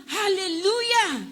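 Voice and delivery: preacher showing how to say hallelujah; joyful hallelujah. Shown as a demonstration. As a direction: model the shout for the crowd. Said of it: "Magsabi ng".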